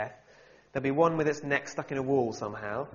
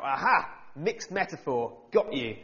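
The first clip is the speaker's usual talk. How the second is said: a hammed-up gotcha, louder and higher; mock pedantic shout; 4 semitones higher, 5 dB louder than usual. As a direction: play a smug nitpicker pouncing on a mistake. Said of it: "get his reading glasses out and shout".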